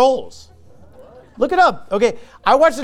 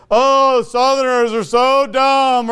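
mock sneer; 4 semitones higher, slower than usual. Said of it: "It's like".

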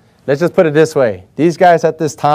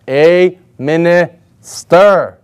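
slow emphatic answer; much slower than usual. Shown as a demonstration. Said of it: "What is he known as?".

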